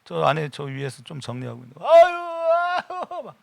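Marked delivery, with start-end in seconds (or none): 1.8-3.2 slow, high moaning groans; pained groaning. Retold or imitated. Deacon in pain groaning while tidying upstairs. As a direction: drag out each groan like an aching body. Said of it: "막 아파".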